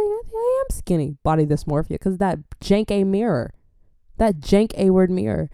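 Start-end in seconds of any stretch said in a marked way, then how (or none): 0.0-1.2 pitched way up, protesting; squeaky insistence. Retold or imitated. Arguing with her dad that she is skinny, high squeaky protest.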